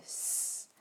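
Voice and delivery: "S" as a long hissed sss; soft, drawn-out hiss for "S"; letter sound S. Shown as a demonstration. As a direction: sustain a voiceless sss for "S". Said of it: "ça fait".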